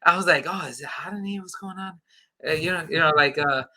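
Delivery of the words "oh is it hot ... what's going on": hushed, softer nervous aside; a touch of flustered nerves, much softer; nervous mock fluster. Facing the big reveal, a softer nervous mutter. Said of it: "I was getting a little bit nervous".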